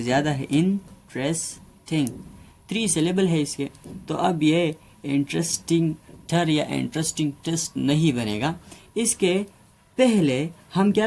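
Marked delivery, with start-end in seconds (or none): none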